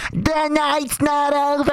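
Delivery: high, slow, drawn-out taunt; mock yelled taunt. Nico yelling from his car as he drives off, high and slow. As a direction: drag out every word like a parting threat. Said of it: "Nico yelled outside of his car".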